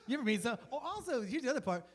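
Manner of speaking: ordinary talk, nothing marked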